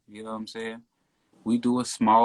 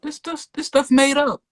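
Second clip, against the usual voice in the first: very high-pitched protest; incredulous denial; 12 semitones higher than usual. Real, not acted. Driver denying to interviewer he was speeding, incredulous.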